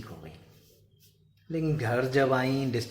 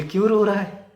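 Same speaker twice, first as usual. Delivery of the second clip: high, loud, startled question; surprised question; 10 semitones higher, 10 dB louder than usual. Retold or imitated. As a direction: blurt it out in surprise. Mother asks why buffalo cries.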